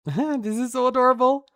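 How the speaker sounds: high, loud giggly squeal over cute art; squealing laugh. Real, not acted. Fan reacting to adorable art, squealing with laughter. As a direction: laugh it out, overwhelmed by cuteness.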